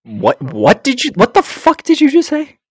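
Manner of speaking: high-pitched, disbelieving demand; stammered start into a high, incredulous squawk; incredulous outburst. Real, not acted. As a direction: shoot way up in pitch, aghast at the remark. Reacting to a jab at their country.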